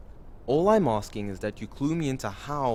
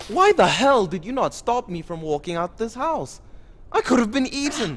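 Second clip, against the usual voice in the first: high, loud indignant yell; angry outburst; 9 semitones higher, 7 dB louder than usual. Acted. Complaining to his companions, loud and indignant. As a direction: blow up at her about nearly dying. Complaining he was left to walk out.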